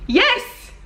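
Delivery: very high, squealed comic reply; mock exasperated yes. Answering someone who asks who they are, mock exasperated. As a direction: squeal it as if it were obvious.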